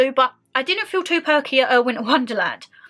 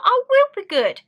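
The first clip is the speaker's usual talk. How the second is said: much higher, sing-song innocent promise; mock innocent; 12 semitones higher, 4 dB louder than usual. Daughter promising mum, high mock-innocent voice. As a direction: chirp it like a cheeky good girl. Mock reply to mum's "be good.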